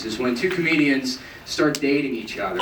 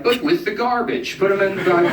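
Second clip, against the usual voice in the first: bouncer; 8 semitones higher than usual. Retold or imitated. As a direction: toss the guy out with the trash. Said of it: "And they're like".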